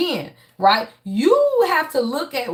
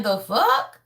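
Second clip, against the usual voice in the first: a mock-incredulous God, rising at the end; 3 semitones lower than usual. Tarot reader voicing God scoffing at the viewer, mock incredulous. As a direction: scoff at them in disbelief. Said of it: "God is saying".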